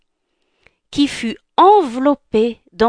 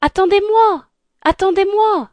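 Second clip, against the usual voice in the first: calling out; 12 semitones higher, 4 dB louder than usual. Acted. Cendrillon calling after someone.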